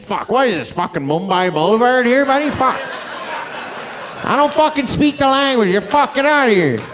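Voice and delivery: hammed-up irate ranting, rising loud and high; mock angry driver. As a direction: build to an exasperated mock yell.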